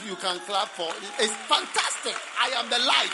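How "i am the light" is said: higher and much louder, thrilled; excited loud proclamation. Acted. Preacher marvelling at the claim before the church, excited loud cry. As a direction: shout it in wonder.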